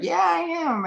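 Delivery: high, cocky, unhinged; crazy wrestler voice. Retold or imitated. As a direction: squeal it like a crazy man.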